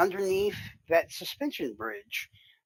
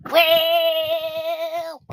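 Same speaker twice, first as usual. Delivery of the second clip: long, loud, high held "well"; drawn-out high note; 12 semitones higher, 9 dB louder than usual. Pretend. Streamer trying a silly voice for viewers, drawn-out high note. Answers which voice would you like.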